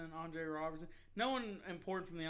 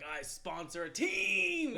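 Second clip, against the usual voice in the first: smug; 8 semitones higher, 5 dB louder, much slower than usual. Pretend. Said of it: "I'd be like".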